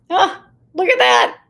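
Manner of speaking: light, high, playful "oh look at that"; amused shrug. Pretend. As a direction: laugh it off brightly. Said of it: "I could have gone".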